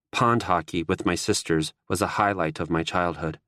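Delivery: ordinary delivery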